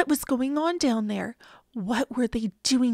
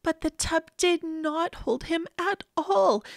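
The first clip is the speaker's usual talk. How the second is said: ordinary delivery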